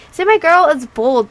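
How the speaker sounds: loud, slow, emphatic brag; proud and excited. Real, not acted. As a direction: boast about her loudly. Proud aside to the audience.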